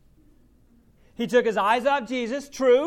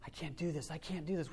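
much softer, lower, to himself; muttering doubt; 6 semitones lower, 12 dB quieter than usual. Retold or imitated. Doubting Peter to himself, much softer and lower. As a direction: mutter it in dread. Doubting self talk whispered.

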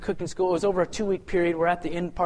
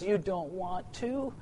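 God's quiet question; 9 dB quieter, slower than usual. Retold or imitated. God questioning him.

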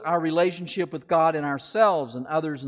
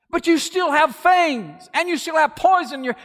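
alarmed objection; 13 semitones higher, 7 dB louder than usual. Acted. Young man objects to snake.

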